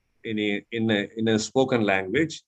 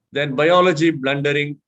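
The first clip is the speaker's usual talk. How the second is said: ordinary talk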